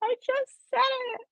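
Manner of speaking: high, laughing at her own slip. Real, not acted. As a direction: giggle through it at the mistake. Reader laughing at own flub.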